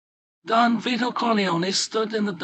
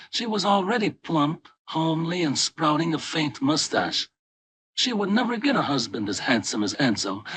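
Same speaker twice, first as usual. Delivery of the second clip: usual speaking voice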